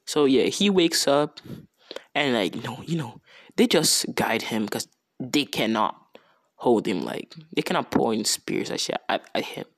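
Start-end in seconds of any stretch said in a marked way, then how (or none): none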